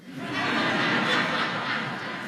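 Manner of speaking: short airy laugh; breathy laugh. A narrator's reading. Narrator laughing after teasing the rabbi, airy laugh.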